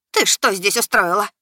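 a mild shrill outburst; shrieking waitress. A narrator's reading. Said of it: "пронзительно взвизгнула подавальщица". (пронзительный визг подавальщицы)

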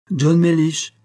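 sudden call. A narrator's reading. Talbot suddenly confronting his sulking friend, loud and sharp. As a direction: snap him to attention. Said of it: "dit Talbot subitement".

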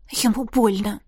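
plain reading voice

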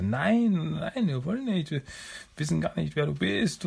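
high, pleading turtle voice; captured turtle protesting to the monster, high and pleading; pleading Mikey. Retold or imitated. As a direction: beg the monster to believe you.

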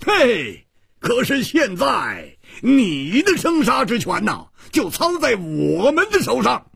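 very high, hot-headed challenge; impulsive shout. A narrator's reading. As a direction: burst out in a high-pitched taunting cry. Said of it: "忍不住喝道". (忍不住高声喝道)

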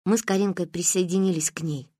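plain reading voice

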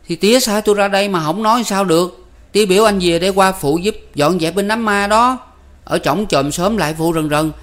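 put-on a kid rattling off news; boy Năm. A narrator's reading.

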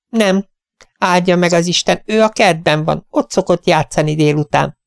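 high, bright-voiced welcome from a tiny hostess; cheery little lady. Tiny hostess welcoming the vets at her door, high and cheery. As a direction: greet the gentlemen like a delighted little old lady.

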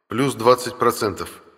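ordinary delivery, nothing marked

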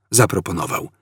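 ordinary delivery, nothing marked

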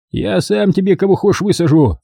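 high, cocky boast; boastful tough guy. A narrator's reading. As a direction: brag with swagger. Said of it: "ответственно заявил он".

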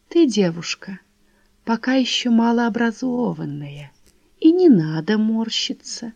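put-on slow whispering old man; a creaky old man lecturing softly. A narrator's reading.

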